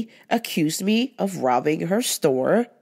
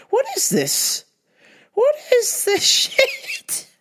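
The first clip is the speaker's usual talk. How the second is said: high, stretched-out disbelief with a laugh; reacting in shock to the customer, very high; incredulous; slower than usual. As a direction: squeak out your outrage.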